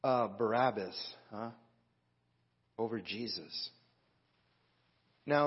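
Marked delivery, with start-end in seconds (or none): 0.0-3.7 much softer, low and subdued; moved nearly to tears. Retold or imitated. Preacher retells praying to the Lord on his recliner, quietly moved. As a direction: quietly retell a tearful private prayer. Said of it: "I actually started crying".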